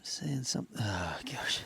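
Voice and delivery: hushed whisper over the find. Real, not acted. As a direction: breathe it out in a whisper. Spots a find in the hole.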